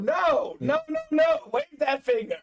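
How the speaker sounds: high, teasing no no no; Mutombo finger wag. Retold or imitated. Host imitating Mutombo's refusal for a buyer, high teasing. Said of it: "He's like the Kevin Mutombo".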